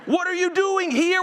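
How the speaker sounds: high-pitched, exasperated echo of a question; losing his mind. Acted. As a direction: throw the question back in acted disbelief. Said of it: "I'd probably lose my mind".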